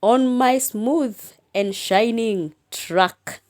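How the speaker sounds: slow, lifted recitation; slow poem reading. Shown as a demonstration. Teacher reciting the final poem line, slow. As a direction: draw out the last line. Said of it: "And the last line".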